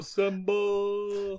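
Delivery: long, high held-out call; mock rallying call. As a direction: echo the summons, stretched out slowly and high.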